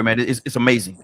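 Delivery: usual speaking voice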